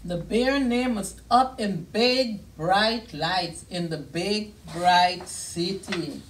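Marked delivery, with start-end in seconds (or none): none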